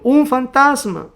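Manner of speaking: loud, high, drawn-out cry of alarm; slow, high dramatized shout; fearful cry. Acted. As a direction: cry out as frightened disciples. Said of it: "dieron voces de miedo".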